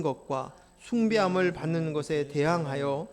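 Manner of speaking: formal reading of the text; scripture reading. Acted. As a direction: read the verse out to the congregation. Reads scripture aloud.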